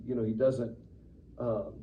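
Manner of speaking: ordinary talk, nothing marked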